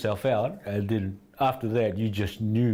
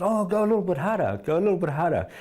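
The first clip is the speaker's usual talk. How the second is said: egging the fighters on, lightly voiced coach; imitating Sifu; 8 semitones higher, faster than usual. Coach Sifu urging sparring fighters.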